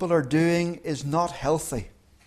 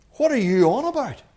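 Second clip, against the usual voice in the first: puzzled labourer; 7 semitones higher than usual. Retold or imitated. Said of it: "speaks in Hindi".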